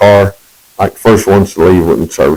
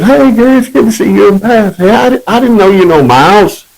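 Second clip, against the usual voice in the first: cheerful greeting; 12 semitones higher than usual. Retold or imitated. Alvin greets couple cheerfully.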